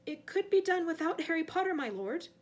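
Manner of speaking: timid servant daring to suggest; nervous rush. A narrator's reading. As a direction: force the words out before nerve fails. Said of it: "the words tumbling from him in a rush".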